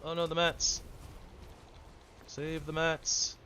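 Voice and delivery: panicked cry. Real, not acted. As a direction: yell in alarm to save the loot. Loot falling into the lava.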